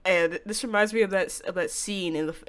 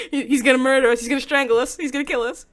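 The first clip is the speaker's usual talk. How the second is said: louder, higher, playful alarm; gleeful freaking out; excited panic; 7 semitones higher, 9 dB louder than usual. Real, not acted. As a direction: sound thrilled and scared at once. Panicking about the killer.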